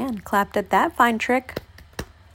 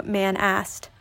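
plain reading voice

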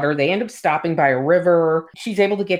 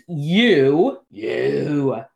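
growling wolf; 3 semitones lower than usual. Retold or imitated. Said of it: "It growls".

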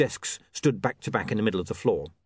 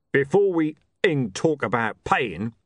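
slow, heavy-hitting thug voice; menacing thug, slow and forceful; thuggish Mister Tulip; 3 semitones higher, 4 dB louder, slower than usual. Acted. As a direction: lean on each word slowly, with menace. Thug Tulip threatens the wizard.

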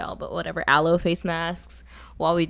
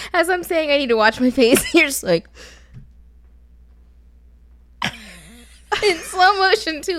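laughing; 6 semitones higher than usual. Real, not acted. Laughing while talking.

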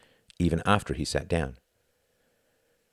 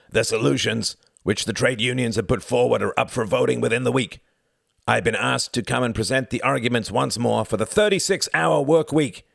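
raspy voice; 7 semitones higher, 4 dB louder than usual. A narrator's reading. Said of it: "he cried in a raspy voice".

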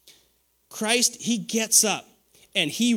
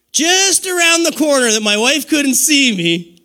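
excited; 7 semitones higher, 6 dB louder than usual. Real, not acted. Excited storytelling, louder.

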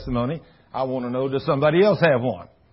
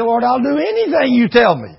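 pleading repentance; 8 semitones higher, 6 dB louder than usual. Retold or imitated. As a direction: beg for his life. Man begs God.

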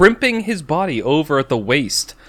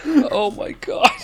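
laughing; 10 semitones higher than usual. Real, not acted. Still laughing at passage.

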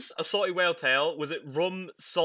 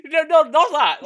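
much higher, louder protesting no; 11 semitones higher, 11 dB louder, slower than usual. Real, not acted. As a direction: cut them off loudly. Protesting misunderstanding.

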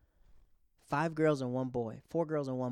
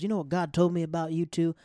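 loud, higher preacher voice; 4 semitones higher, 9 dB louder than usual. Retold or imitated. A grateful attendant preaching to the dad and mom, loud. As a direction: preach it at them. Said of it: "now he becomes a preacher".

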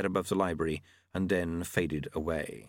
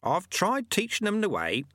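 put-on high-pitched, mildly proud old master; 11 semitones higher than usual. A narrator's reading. Monk speaking of teaching the yetis, proudly. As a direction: say it high, with a touch of pride. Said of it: "said Lutze proudly".

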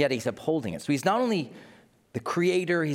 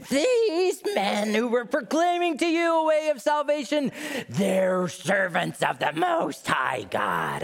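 shrill hammed-up witch proclamation; Wicked Witch of the West; 11 semitones higher than usual. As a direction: screech it like a storybook witch. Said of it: "Wicked Witch of the West".

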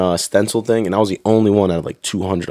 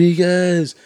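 high-pitched mock summons; mock call across the room; trooper calling out; 9 semitones higher, slower than usual. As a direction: imitate a trooper calling a recruit out. Trooper calls recruit out by name.

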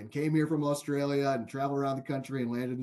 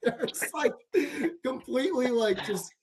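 broken up by laughter, higher pitched; laughing; 6 semitones higher than usual. Real, not acted. Amused host telling of Hartford's drop to Division three, laughing. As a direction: laugh through the words, struggling to get them out.

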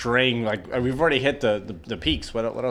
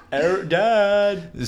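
laughing; 7 semitones higher, 4 dB louder, much slower than usual. Real, not acted. Laughing after mocking.

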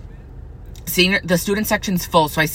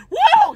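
very high sarcastic whoop; scene: vlogger mocking the gossiping bullies with a shrill whoop; 5 dB louder than usual. Real, not acted.

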